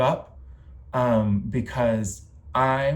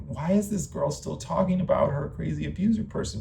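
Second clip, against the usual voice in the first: higher, lightly mocked listener's question; 6 semitones higher than usual. Imitating a listener who asks why, lightly voiced in higher pitch. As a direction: put on a puzzled onlooker. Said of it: "being like".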